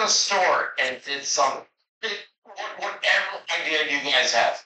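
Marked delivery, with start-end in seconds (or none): none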